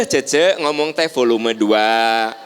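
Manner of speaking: usual speaking voice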